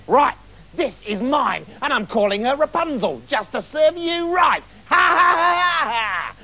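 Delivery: high shouted witch cackle; evil high cackle; cackling witch. Acted. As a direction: gloat and cackle. Witch takes the baby.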